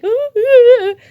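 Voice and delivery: squeaky high voice breaking on each ooh; voice cracking. Shown as a demonstration. Vlogger mocking her own cracking voice, squeaky breaking oohs. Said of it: "My voice is like".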